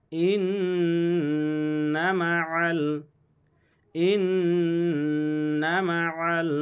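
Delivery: slow chant with a long nasal ন্ন in ইন্না; Quran recitation. Shown as a demonstration. Teacher reciting with the nasal ghunna for students. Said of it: "গুন্না ওয়াজিব".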